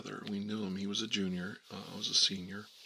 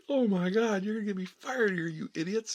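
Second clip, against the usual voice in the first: high, raised imitation of a panicked teacher; acted teacher losing it; exasperated outburst; 12 semitones higher, 5 dB louder than usual. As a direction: despair loudly at the kids, pitched up. Exasperated teacher fearing firing, acted.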